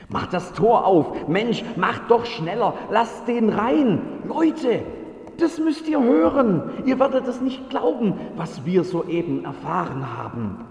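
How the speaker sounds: hoch und laut, drängend; aufgeregter Ruf. Acted. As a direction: die Leute aufgeregt herbeirufen. Wächter ruft aufgeregt die Leute. (Wächter ruft aufgeregt die Leute zusammen)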